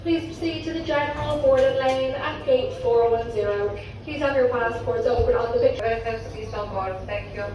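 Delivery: airport announcement. Real, not acted. Second agent's boarding call.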